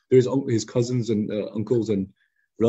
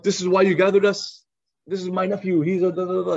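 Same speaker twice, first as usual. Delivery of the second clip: mock angry uncle; 7 semitones higher than usual. Uncle Abu Lahab mocks nephew before the crowd, raised angry voice. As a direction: scoff at the nephew in front of the crowd. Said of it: "He's like".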